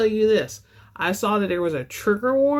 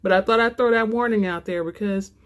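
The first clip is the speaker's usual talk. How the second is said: ordinary delivery, nothing marked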